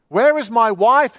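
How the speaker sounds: loud, high scream of a question. A narrator's reading. Lost man screams for his wife, loud and high. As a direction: scream it into the darkness. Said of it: "he screamed".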